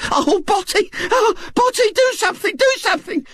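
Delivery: a wife beside herself with panic; hysterical. Acted. Mrs Bott begs her husband to do something, hysterical. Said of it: "said Missus Bott hysterically".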